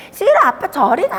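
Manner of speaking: put-on high, loud childish refusal; 아빠를 거부하는 아이. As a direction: push dad away like a little kid. 아이가 아빠를 밀어내는 장면. (싫다는 아이처럼 높고 크게)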